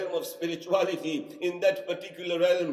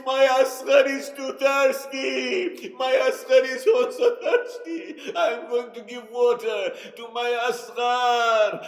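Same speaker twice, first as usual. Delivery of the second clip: pleading cry; 4 semitones higher, 6 dB louder than usual. Acted. Little girl insisting she must bring water, loud plea. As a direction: beg for water for the baby brother. Sakina pleads for baby brother.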